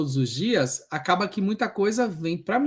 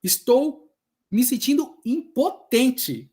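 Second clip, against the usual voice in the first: confessing helplessness; 6 semitones higher, 7 dB louder, much slower than usual. Retold or imitated. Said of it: "E eu falei pra ela".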